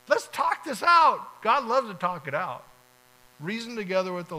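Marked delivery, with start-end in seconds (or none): 0.1-1.4 much higher, louder and slow, a rousing call; emphatic invitation. Real, not acted. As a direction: call out warmly and slowly to the congregation. Preacher voices God's invitation.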